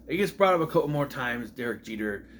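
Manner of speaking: usual speaking voice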